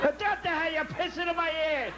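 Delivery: high-pitched outraged yell; acted shocked outburst; shouting. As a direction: holler like a startled grandpa. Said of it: "You don't have to shout".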